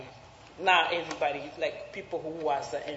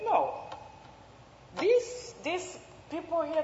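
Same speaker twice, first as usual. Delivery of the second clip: lightly voiced stern official; 11 semitones higher than usual. Official voiced stopping them.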